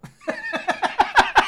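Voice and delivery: sudden high cackle; high burst of laughter. Real, not acted.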